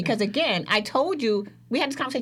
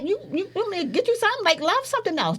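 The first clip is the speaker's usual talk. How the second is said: much higher, fast incredulous offer; rapid incredulous; 10 semitones higher, faster than usual. Retold or imitated. Scolding a friend in love, fast incredulous. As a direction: fire it off in disbelief.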